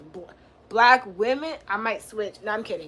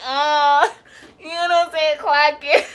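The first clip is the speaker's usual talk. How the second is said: laughing, close to tears; 4 semitones higher, 5 dB louder than usual. Real, not acted. Laughing at own story.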